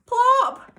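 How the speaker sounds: high, loud splash word; plop. A narrator's reading. Narrator reading to kids makes the splash, high and loud. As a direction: pop it out like a splash. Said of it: "sound word in story".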